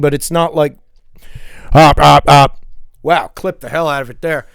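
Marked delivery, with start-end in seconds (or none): 1.7-2.6 loud popped Op sounds; popping plosives. Shown as a demonstration. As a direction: pop the P hard into the mic. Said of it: "it's picking up something".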